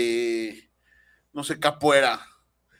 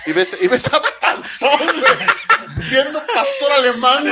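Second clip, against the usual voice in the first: laughing; 6 semitones higher, 8 dB louder, slower than usual. Real, not acted. Laughing while telling absurd scenario.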